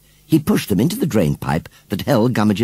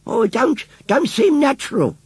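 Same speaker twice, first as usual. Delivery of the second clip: put-on much higher old woman, doubtful; old lady murmuring. A narrator's reading. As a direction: muse it like a suspicious old woman. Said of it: "murmured Missus Kibbins".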